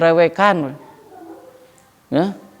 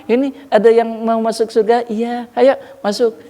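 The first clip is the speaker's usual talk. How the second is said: smiling angel welcome; 7 semitones higher than usual. Acted. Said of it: "Malaikat Ridwan senyum".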